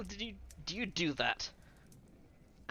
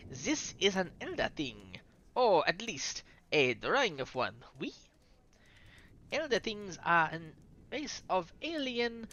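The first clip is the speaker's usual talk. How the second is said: put-on French accent; 3 semitones higher than usual. Lecturing player group on the elder thing drawing, French accent. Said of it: "Here's a French voice coming".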